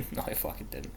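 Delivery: a low breathy aside with no voice; whispered confession. Real, not acted. Streamer owning up that he didn't know, whispered. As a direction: whisper the admission to yourself. Admits lie after laughing.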